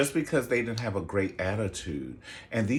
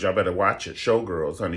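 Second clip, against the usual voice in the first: ordinary talk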